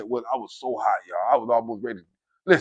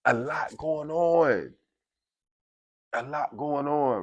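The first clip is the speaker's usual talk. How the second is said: slow, drawn-out words; slow, softer emphasis; weighty repetition; 6 dB quieter, slower than usual. Real, not acted. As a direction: stretch it out and let it sink in. Dwelling on troubles, repeats slowly.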